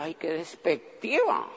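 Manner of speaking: soft, slow amused mutter; laughing aside. Retold or imitated. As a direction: chuckle it under your breath. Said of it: "Y yo decía".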